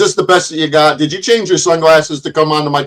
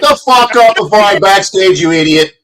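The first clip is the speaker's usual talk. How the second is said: raised, heated angry shutdown; angry command; 5 semitones higher than usual. Real, not acted. Host ordering an interrupting guest silent, angry command. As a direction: talk right over them, pitch raised.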